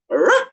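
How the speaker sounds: sharp high mock bark; dog bark imitation. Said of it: "Parang mukha na rin akong aso".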